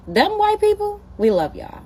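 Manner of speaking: sing-song high call to get their attention. Real, not acted.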